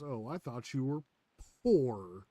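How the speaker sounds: mock taunt with a pause before a loud last word; Damon Darling catchphrase. Streamer mimics prankster taunting a stranger, mocking punchline. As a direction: hold back, then hit the punchline hard. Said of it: "the way he says it".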